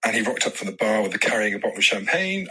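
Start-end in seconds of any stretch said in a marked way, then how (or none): none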